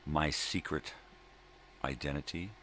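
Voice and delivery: ordinary delivery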